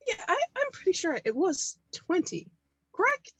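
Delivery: high-pitched, halting, up to a questioning end; hesitant questioning. Acted. Buyer checking the agreed price with seller, tentatively insistent. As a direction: politely press for confirmation. Player voices character querying price.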